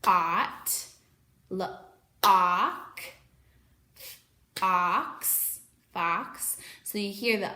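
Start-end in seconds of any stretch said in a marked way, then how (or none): 0.0-0.9 "ot" with a short open ah vowel; short O sound. Shown as a demonstration. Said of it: "short O sound, like in hot". 1.5-3.0 "ock" with a short open ah vowel, louder; short O sound. Shown as a demonstration. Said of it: "short O sound". 4.6-5.6 "ox" with a short open ah vowel; short O sound. Shown as a demonstration. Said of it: "short O sound".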